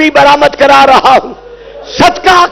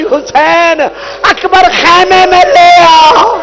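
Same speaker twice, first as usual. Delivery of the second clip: crying call from tent; 4 semitones higher, much slower than usual. Acted. Woman in tent calls Husain through sobs. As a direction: cry out through tears. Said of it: "خیمے سے آواز آئی".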